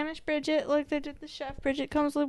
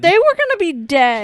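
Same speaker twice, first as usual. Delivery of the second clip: loud retort; 3 semitones higher, 14 dB louder than usual. Real, not acted. Arguing the chef deserved it.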